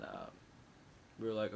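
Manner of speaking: usual speaking voice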